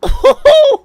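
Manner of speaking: very high, very loud laughing whoop; real burst of shrieking laughter; ecstatic laughing whoop. Real, not acted. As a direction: explode into a high delighted whoop. Reaction to haystack landing.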